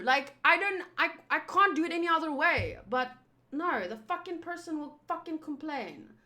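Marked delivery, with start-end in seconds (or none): none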